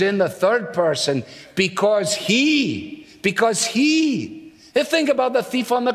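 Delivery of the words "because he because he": HE long drawn out, falling; stress on HE. Shown as a demonstration. Preacher gives the proper third-person answer, stressing HE. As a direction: stretch "he" slowly and let it fall.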